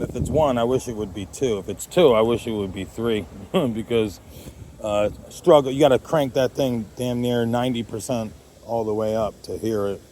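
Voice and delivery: ordinary talk, nothing marked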